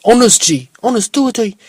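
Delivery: high, punchy put-on slang voice. Podcaster playing with a voice from earlier, put-on and high. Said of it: "I was putting on, um, that voice".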